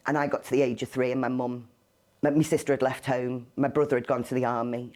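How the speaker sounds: normal talking voice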